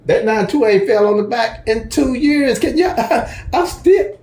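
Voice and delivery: high-pitched, breaking into a real laugh; laughing disbelief. Real, not acted. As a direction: voice climbs in exasperated amusement, then laughs. Griping about overdue number, laughing.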